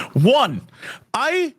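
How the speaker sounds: half laughing, half crying; words catch in a teary laugh; on the edge of tears; choked-up laugh. Real, not acted. Crying over the game ending.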